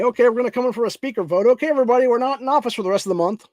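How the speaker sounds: high, loud sneering mimicry of a breezy official; mocking politicians. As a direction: ham up a politician brushing everyone off.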